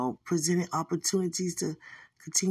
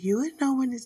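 lightly voiced serene angel; angel's way of talking; 7 semitones higher, 3 dB louder, much faster than usual. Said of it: "this how they talk".

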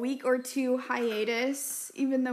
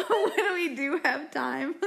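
laughing; 4 semitones higher, slower than usual. Real, not acted. Cracking up mid-sentence.

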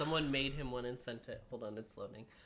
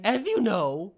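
King Candy; 6 semitones higher, 13 dB louder, slower than usual. Pretend. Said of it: "I sound like the Mad Hatter".

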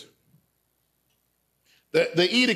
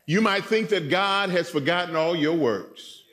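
ordinary talk, nothing marked

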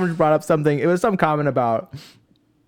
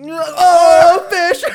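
very high, loud drawn-out yell; mock outburst; 9 dB louder than usual. Co-host derailing a cool story, mock yelled outburst. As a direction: holler it out as a gag.

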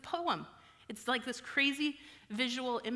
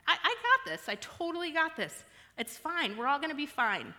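forced cheer; 6 semitones higher, 3 dB louder than usual. Retold or imitated. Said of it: "I say things to myself like".

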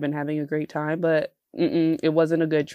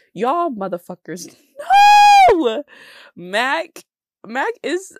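loud laughing outburst; laughing shout; 9 semitones higher, 8 dB louder, slower than usual. Real, not acted. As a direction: yell it between laughs. Outrage at Mac prices.

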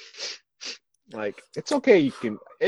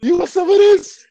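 mock challenge; 13 dB louder than usual.

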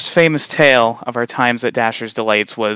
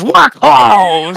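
loud high barked refusal; aggressive snap; 10 semitones higher, 6 dB louder than usual. Retold or imitated. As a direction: bark it straight at the kid. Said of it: "in quite a slightly aggressive tone".

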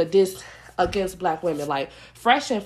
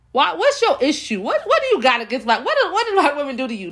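indignant high challenge; 7 semitones higher than usual. Real, not acted. Rant confronting host, pitch up.

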